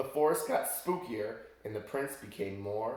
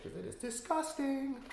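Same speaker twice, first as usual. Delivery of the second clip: disgusted; 3 dB quieter than usual. Real, not acted. Reader shows disgusting apple.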